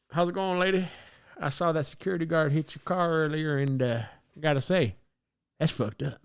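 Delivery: a hint of a smooth Southern charmer; Southern accent. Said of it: "I fucking speak in a Southern accent".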